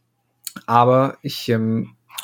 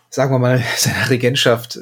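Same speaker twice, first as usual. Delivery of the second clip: ordinary delivery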